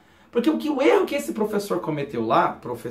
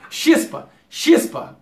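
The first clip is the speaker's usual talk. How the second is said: sharp, loud shoo-away; 7 semitones higher, 4 dB louder, much slower than usual. Shown as a demonstration. Teacher showing slang to scram, loud. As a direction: chase someone off. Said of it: "A gente fala".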